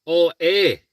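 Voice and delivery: light Minnesota "Oh, hey"; a quick Midwestern hello; mild Minnesota twang; Minnesota accent. Retold or imitated. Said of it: "is that something you say?".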